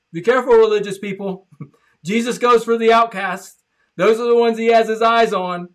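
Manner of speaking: loud, high preaching with a laugh; full-force preaching. Acted. Preacher warns religious people, loud full-force preaching. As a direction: build up and warn the church crowd.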